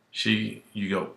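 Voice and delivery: ordinary talk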